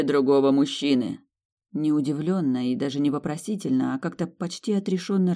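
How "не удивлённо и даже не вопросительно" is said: ordinary delivery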